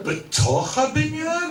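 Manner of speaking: high, slow disbelieving question; can't believe it's inside the building; incredulous question. Retold or imitated. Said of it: "And I say".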